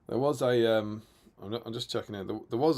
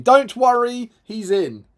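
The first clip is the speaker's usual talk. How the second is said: much higher and louder burst; excited; 10 semitones higher, 11 dB louder, slower than usual. Real, not acted. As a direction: cheer it out. Excited reassurance after message.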